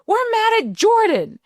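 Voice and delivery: retold daughter blaming her little brother, high child voice; child's voice. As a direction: squeak it like a five-year-old.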